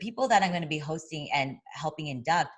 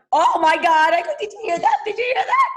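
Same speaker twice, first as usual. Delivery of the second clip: much higher and louder, squealing with a laugh; fangirl squeal; 14 semitones higher, 10 dB louder than usual. Retold or imitated. Starstruck fan squealing after Al Bernstein's compliment.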